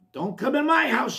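high, loud warning to keep out; polecat's warning cry. A narrator's reading. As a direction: call out sharply, pitch up high. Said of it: "sounded Polecat".

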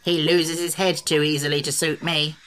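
grumbling gruff creature; vicious. A narrator's reading. Sawhorse complaining about Jack, gruffly vicious. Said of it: "declared the Sawhorse viciously".

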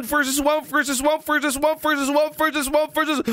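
rattling it off until breath runs out; one long breath, trailing empty; running out of breath. Real, not acted. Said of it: "I'm out of breath".

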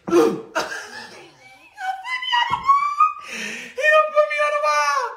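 squeaky high-pitched laughing words; cracking up over being pinned; high giggly telling. Real, not acted.

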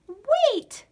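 urgent whispered-shout; girl calling the bear back. A narrator's reading. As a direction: call after the bear. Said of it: "shouted Hortense".

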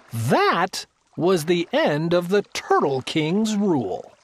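usual speaking voice

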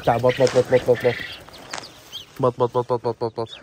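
quick repeated duck call on one level note. Real, not acted. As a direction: coax the duck over with even, repeated calls. Calling a duck to come. (مناداة البطة بتكرار منتظم)

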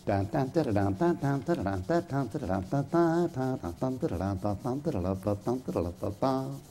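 softly hummed trumpet fanfare, dun-da; trumpet imitation. Preacher imitating the last trumpet for the congregation, softly hummed. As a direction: voice the trumpet call with quiet sung syllables. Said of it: "the trumpet would blow, and it'd go".

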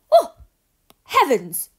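loud startled exclamation; man startled by an owl, crying out. Acted. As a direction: cry out as if suddenly frightened. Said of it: "cried the man".

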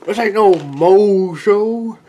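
musician bragging at the crowd, slow loud shout; slow boastful yell. Acted. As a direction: bellow it slowly like a wounded ego. Performer boasts, yelling.

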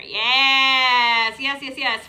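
loud, emphatic yes; big excited approval; delighted confirmation. Real, not acted. Delighted at children's right answer.

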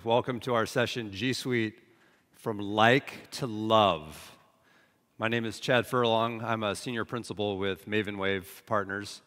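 usual speaking voice

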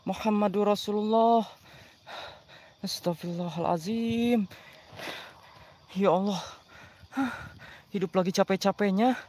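berzikir lirih dan pelan; zikir pelan. Real, not acted. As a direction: menenangkan diri dengan doa lirih. Berzikir menenangkan diri. (zikir lirih karena takut)

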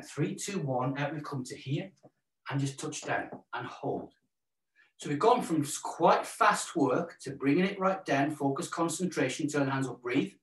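normal talking voice

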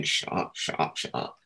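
hushed whispered warning; urgent whisper. Retold or imitated. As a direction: whisper it so the ladies won't hear. Jason hushing him in the meeting.

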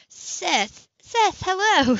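high, loud call across the sidewalk. Acted. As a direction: hail him brightly from a distance. Sybil calls out to Seth.

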